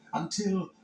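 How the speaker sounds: ordinary delivery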